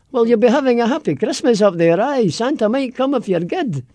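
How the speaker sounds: high, singsong Aberdeen Doric. Shown as a demonstration. Aberdonian promising Santa might come, high Doric lilt. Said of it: "in Aberdeen they have this wonderful Doricky thing".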